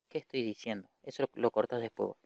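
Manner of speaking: low mumbled note to self and editor; muttered aside. Real, not acted. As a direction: drop out of lecture voice, quietly to the editor. Aside to editor after mistake.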